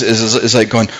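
usual speaking voice

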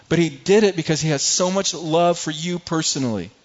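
preacher pleading with the congregation, growing fervent; impassioned preaching. Acted. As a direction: build the sermon to a fervent climax. Preaching builds to full force.

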